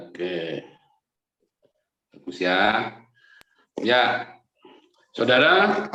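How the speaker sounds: ordinary delivery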